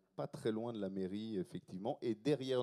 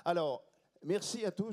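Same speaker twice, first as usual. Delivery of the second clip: mock TV host announcement; 8 semitones higher, 5 dB louder than usual. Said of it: "On dirait Jacques Martin".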